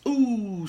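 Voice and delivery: high, loud relishing ooh; gossipy ooh. Acted. Host teasing her audience with gossip, a relishing ooh. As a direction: savour the juicy scandal.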